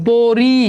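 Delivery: পরি split into long "po" and "ri"; syllable-by-syllable demonstration. Shown as a demonstration. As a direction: stretch both syllables of পরি. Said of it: "আগে র এর উচ্চারণ".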